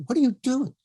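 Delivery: high-pitched, quick indignant question; John Huston's rude challenge. Retold or imitated. Director being rude to the casting lady, high sharp challenge. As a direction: pitch jumps up in rebuke. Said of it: "who's rude to this lady".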